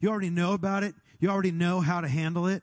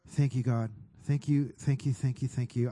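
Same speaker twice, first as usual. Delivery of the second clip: prayer; 4 semitones lower than usual. Acted. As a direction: pray it low and intimate. Prays aloud over the room.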